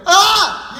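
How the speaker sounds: high, loud laughing scream. Retold or imitated. Rider screaming on roller coaster drop, loud scream. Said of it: "I'm screaming".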